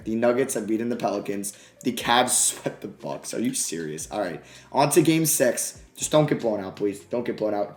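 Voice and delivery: ordinary delivery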